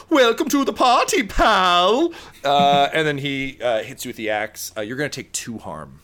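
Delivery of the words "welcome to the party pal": high, loud mocking villain yell; gleeful movie villain shout; villain taunt. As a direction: taunt him like an action movie bad guy. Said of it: "he just turns around and goes".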